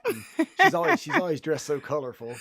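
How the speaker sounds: showy radio host introduction; announcer voice. Acted. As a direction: build it up like an emcee. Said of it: "You said sexy enough".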